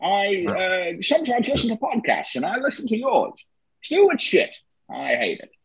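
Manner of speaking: mock Connery telling him off; Scottish Connery voice dismissing the podcast; Sean Connery impression. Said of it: "So that was Sean Connery".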